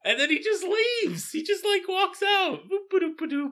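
high, giggly telling; amused, high-pitched and breaking into laughs; laughing through words. Real, not acted. Amused recap, laughing while speaking.

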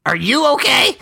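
high-pitched question thrown straight back; incredulous echo. Acted. Player throws question back at Aniston.

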